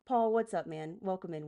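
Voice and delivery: ordinary delivery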